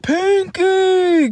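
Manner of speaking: cartoon character voice. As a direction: call "Pinky" in a squeaky cartoon voice. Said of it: "the one where he's like".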